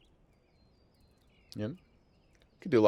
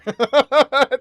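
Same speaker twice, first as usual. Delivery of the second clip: loud burst of laughter; 13 semitones higher, 9 dB louder than usual. Real, not acted. Laughing at own joke.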